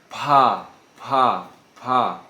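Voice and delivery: pá with a hard puff of air; explosive P. Shown as a demonstration. Teacher shows students the explosive P in pá. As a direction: blow the P of pá out hard against your hand. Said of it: "você tenta, ah, falar uma palavra".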